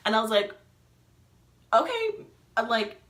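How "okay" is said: high, loud, squeaked reply; startled agreement. Retold or imitated. Answering friend's sudden Texas invitation, high startled agreement. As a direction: re-enact agreeing while taken aback.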